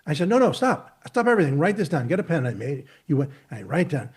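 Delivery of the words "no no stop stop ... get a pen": loud, high urgent orders to stop everything; emphatic urgent command. Pretend. Interrupting a Zoom call to urge a restaurant tip, emphatic and loud. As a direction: insist they grab a pen right now. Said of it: "if I was that emphatic".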